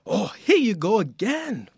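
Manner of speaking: slow Nigerian-sounding father; drawn-out, hammed-up Nigerian accent; bad Nigerian accent. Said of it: "you do bad Nigerian".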